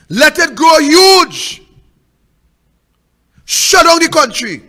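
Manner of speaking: rally the crowd to shut the country down, shouting. Real, not acted. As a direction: roar it like a rally chant.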